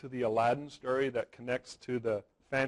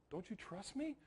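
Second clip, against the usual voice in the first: soft question; 8 semitones higher, 15 dB quieter than usual. Retold or imitated. Aladdin's magical question, retold.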